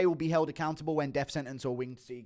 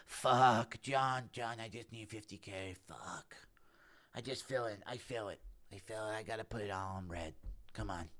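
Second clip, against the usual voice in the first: hushed pleading gambler impression, getting softer; Steve Will Do It; 2 semitones lower, 4 dB quieter than usual. Gambler begging John for fifty K, hushed pleading. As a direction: plead under your breath, sinking away. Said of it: "Impression of Steve will do it asking John".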